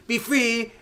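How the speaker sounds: high-pitched heroic proclamation; triumphant mock command. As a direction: call it out like casting a spell. Paige frees her sisters.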